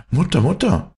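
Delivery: urgent-sounding storybook shout; calling out. A narrator's reading. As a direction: call for your mother, pitched right up. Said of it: "rief er".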